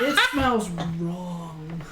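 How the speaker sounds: very slow, strange creature voice; chittering insect creature. Acted. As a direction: drag it out like an odd critter. Creature character speaks slowly.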